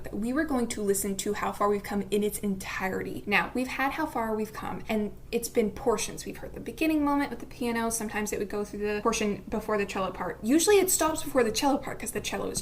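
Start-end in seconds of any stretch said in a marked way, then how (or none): none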